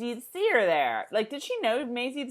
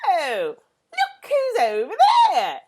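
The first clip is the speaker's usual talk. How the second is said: high, loud hammed-up sweet schoolgirl; mock Claire; 5 semitones higher, 6 dB louder, slower than usual. Mock Claire leaving the two alone, hammed-up and sugary. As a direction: play her as sugary matchmaker, then flounce off. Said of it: "they're walking around like".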